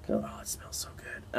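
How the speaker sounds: breathy whispered delight; whispering. Real, not acted. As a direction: whisper it while savoring the smell. Smelling the tobacco, whispered.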